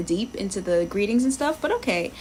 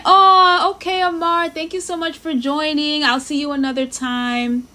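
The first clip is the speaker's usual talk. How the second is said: animated farewell; 6 semitones higher, 4 dB louder than usual. Real, not acted. Streamer sees viewer is leaving.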